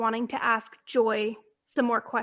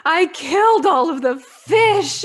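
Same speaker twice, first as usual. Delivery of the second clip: put-on louder, slow small-child confession; little girl confessing; 5 semitones higher, 7 dB louder than usual. Little girl confessing to Mrs Claus, slow and guilty. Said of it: "this little girl is like".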